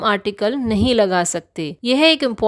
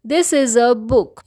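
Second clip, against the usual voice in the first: slow example sentence; much slower than usual. Shown as a demonstration. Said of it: "यह एक किताब है".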